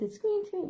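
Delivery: high squeak sound; a quick high hinge creak; door squeak imitation.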